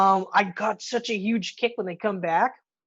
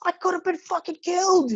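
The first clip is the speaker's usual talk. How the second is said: much higher, mock-horrified outburst; mock shock; 8 semitones higher than usual. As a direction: play up the near-death shock. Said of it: "He's like".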